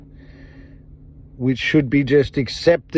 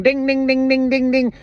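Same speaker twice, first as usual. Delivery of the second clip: bells going off; 10 semitones higher than usual. Retold or imitated. People waking up to the truth, high bell dings. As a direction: mimic warning bells clanging, much higher. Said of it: "bells going off".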